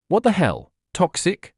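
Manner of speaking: sharp, high snap at the driver; snapping. A narrator's reading. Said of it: "she snapped".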